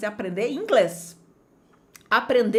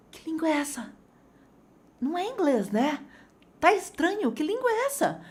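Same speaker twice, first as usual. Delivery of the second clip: puzzled question; 6 semitones higher than usual. Retold or imitated. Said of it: "eu falei".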